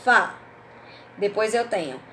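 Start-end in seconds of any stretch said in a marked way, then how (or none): 0.0-0.4 "Fá" said alone, punched loud; stressed syllable. Shown as a demonstration. Teacher to pupils, hitting the stressed syllable loudly. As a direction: isolate the syllable "Fá" and hit it hard. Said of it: "Qual é a sílaba forte?".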